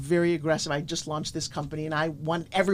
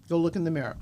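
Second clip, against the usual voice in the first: normal talking voice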